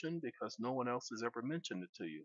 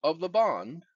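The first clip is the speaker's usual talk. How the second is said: dictating; 2 semitones higher than usual. Real, not acted. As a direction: speak each phrase for voice typing. Dictating to a phone.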